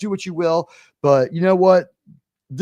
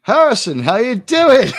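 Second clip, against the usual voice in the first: called-out greeting; 6 semitones higher, 6 dB louder than usual. Acted. Mock hearty greeting called out.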